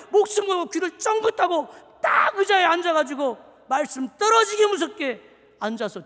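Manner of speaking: loud, high shouted sermon; preaching at full force. Real, not acted. Pastor calling the church back to worship, full-force preaching. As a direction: drive it home at the top of your voice.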